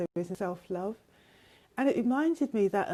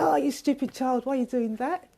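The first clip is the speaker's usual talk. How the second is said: higher-pitched mock telling-off; mock scolding; 4 semitones higher, 4 dB louder than usual. An imagined parent berating a child who fell over, mock scolding. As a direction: scold in exaggerated exasperation, voice raised in pitch.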